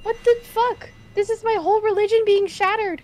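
high, loud disbelief; shocked outburst. Real, not acted. Gamer reacting to her god being caught, loud shock. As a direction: blurt it out, faith shattered.

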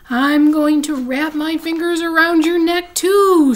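high, loud ghostly howl; spooky ghost howl. Acted. Bean playing ghost to scare her sister, loud spooky howl. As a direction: wail it like a ghost trying to scare. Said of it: "she howled in her spooky voice".

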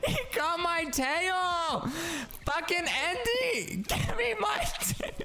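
high, squealing protest through laughter; real high-pitched, half-laughing outrage. Real, not acted. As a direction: squeal indignantly at a friend who robbed you. Andy takes the player's tail.

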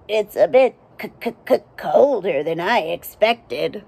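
stuttering teeth-chattering c-c-c; shivering from cold. Acted. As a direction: chatter it out like a freezing frog.

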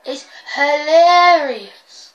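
loud, very drawn-out hilarious; gleeful retort. Retold or imitated. Gamer answering the fouled player, loud gleeful retort. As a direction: stretch it out loud and mocking.

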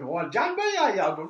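loud, high Geordie exclamation; Geordie accent. Retold or imitated. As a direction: blurt it out in broad Geordie. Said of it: "if you imagine this in a Geordie accent".

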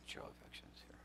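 hushed, breathless whisper; whispered plea. Real, not acted. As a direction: lean in and whisper the appeal. Whispered appeal.

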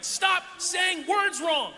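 high, loud, drawn-out plea; exasperated yell. Retold or imitated. Newcomer driven crazy by Wisconsin speech, exasperated yell. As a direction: yell each word slowly in mock agony. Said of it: "it was like a, a drill in my brain".

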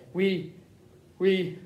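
halting, with long pauses between restarts; understated struggle to speak; voice breaking. A narrator's reading. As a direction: falter on the first word before getting it out. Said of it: "his voice breaking over the last few words".

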